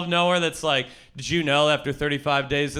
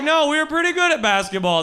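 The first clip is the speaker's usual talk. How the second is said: grandpa; 11 semitones higher, 4 dB louder than usual. Retold or imitated. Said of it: "He's like".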